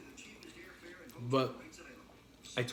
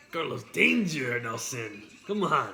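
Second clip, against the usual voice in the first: louder, hammed-up mock Spanish accent; Carlos Danger accent; 5 dB louder than usual. Shown as a demonstration. Urging Nelson on, loud mock Spanish accent. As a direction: punch the name in a big fake Spanish accent. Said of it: "I had an accent".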